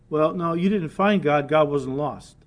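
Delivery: ordinary delivery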